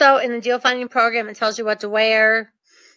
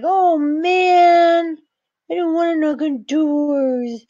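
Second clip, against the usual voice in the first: drawn-out, higher-pitched mock whine; whiny reluctance; 6 semitones higher, much slower than usual. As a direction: imitate a reluctant student groaning about homework. Mimics listeners dreading the homework.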